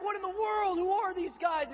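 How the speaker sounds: high, loud disbelief; loud astonishment. Retold or imitated. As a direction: exclaim it in baffled amazement. Acted astonishment at unending shout.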